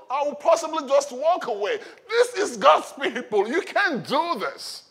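high-pitched, loud righteous indignation; fiery preacher voicing an angry challenger. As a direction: cry out in outrage, stretching the words. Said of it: "He was angry in his spirit".

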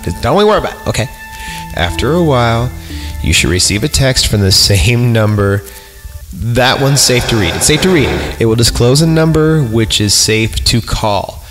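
ordinary talk

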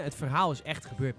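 ordinary delivery

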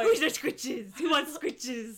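put-on laughing dog baby talk; pet talk. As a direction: coo at a pet. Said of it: "him rubbing him like a dog".

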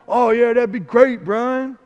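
lightly voiced easygoing God; a touch of a breezy God impression; casual God voice. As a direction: answer like a relaxed pal on the phone. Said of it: "I don't know why I'm talking like that".

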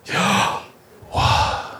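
Yahweh as pure breath, inhale-exhale; Yahweh whispered like slow breathing; breathing sound. Shown as a demonstration. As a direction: breathe "Yahweh" as two long voiceless puffs, YAH-WAH.

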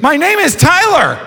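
high, slow mock whine of a kid; frustrated kid. Overlooked kid insisting on his own name, high and slow. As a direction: plead to be called by name, high and drawn out. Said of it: "I was like".

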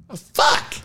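frustrated shout. Retold or imitated. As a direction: yell it out, fed up. Packing up again, frustrated.

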